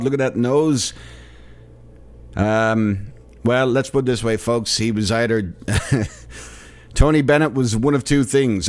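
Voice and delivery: ordinary delivery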